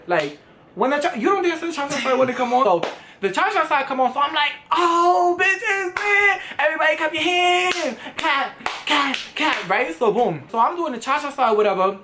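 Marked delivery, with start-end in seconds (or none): 4.7-9.7 high, excited hype-man shout to the room; hyped party call. Retold or imitated. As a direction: pump up the whole club, pitch way up. Hyping the club at Cha Cha Slide.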